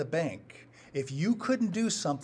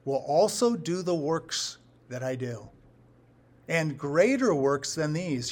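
slow, stressing "greater"; slow and weighty; 5 dB louder, slower than usual. Shown as a demonstration. Preacher reads Jesus' promise to the church, slow and deliberate. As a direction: lay out the promise word by word, slowly. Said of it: "here's your promise".

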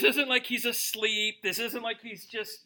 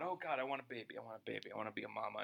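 low, very soft plea to God; hushed imitation of a woman praying; pleading prayer; 9 semitones lower, 15 dB quieter, much faster than usual. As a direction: murmur it privately, pleading over and over. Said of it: "she would plead with God".

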